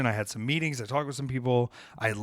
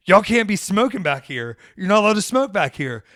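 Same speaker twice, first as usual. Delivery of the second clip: higher, louder bossy telling-off; lightly voiced scolding staffer; mock scolding; 7 semitones higher, 7 dB louder than usual. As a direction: imitate a fussy staffer shooing him out. Costumed staffer scolding him.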